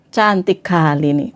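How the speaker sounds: low, slow crooning husband; admiring husband. Acted. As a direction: play a husband sweet-talking, deep and drawn out. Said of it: "suami bilang tampak cantik". (suami merayu, suara rendah dan lambat)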